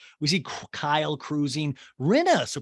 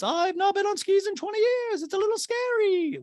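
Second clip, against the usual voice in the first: high nervous lady voice; Dorit impression. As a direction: play Dorit scared. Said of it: "Dorit in a talking head goes".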